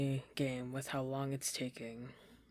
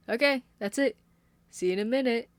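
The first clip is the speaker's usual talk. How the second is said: high, loud chirpy sign-off; sweet sing-songy; 9 semitones higher, 10 dB louder than usual. Acted. Caitlin jumping from the table, chirpy sing-song. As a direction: chirp it as she hops down. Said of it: "the sweet, sing-songy way".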